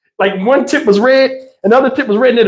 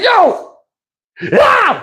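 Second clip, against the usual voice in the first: calling out; 12 semitones higher than usual. Retold or imitated. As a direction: holler at the runaway kid. Calling brother, imitated scream.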